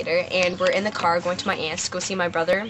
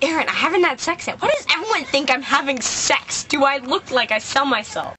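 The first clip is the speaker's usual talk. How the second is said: higher, indignant disbelief; exasperated protest; 6 semitones higher than usual. Retold or imitated. Sister, fed up with her brother, indignant protest. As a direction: throw it back at him, fed up.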